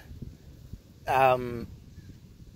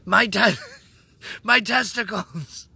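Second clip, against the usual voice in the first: high, loud mock wail of pain; hurt young child; 10 semitones higher, 8 dB louder, slower than usual. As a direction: imitate a small kid crying out, hammed up. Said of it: "I was so young. I was like".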